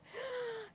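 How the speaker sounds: soft, high intake of breath; admiring gasp. Real, not acted. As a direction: gasp softly in awe. (মুগ্ধ হয়ে শ্বাস টানা)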